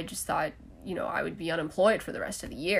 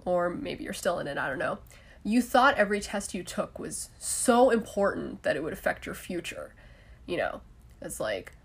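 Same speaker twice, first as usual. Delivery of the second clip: normal talking voice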